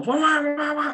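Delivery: very high warbling siren noise; high-pitched repeating electronic wah; imitated device alarm. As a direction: mimic an alarm still wailing. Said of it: "And it's still".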